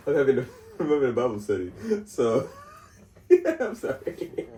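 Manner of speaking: fighting giggles, high and loud; real laughing. Real, not acted. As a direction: try to get the line out through laughter. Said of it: "I don't know why you're laughing".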